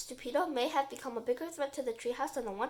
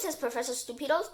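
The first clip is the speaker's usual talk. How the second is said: ordinary delivery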